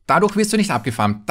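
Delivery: normal talking voice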